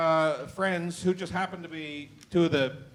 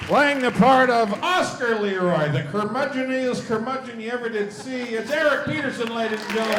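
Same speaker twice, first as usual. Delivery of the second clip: booming game-show announcer; announcer intro; 6 semitones higher, 5 dB louder than usual. Acted. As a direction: sell each name to the crowd. Host introduces cast members.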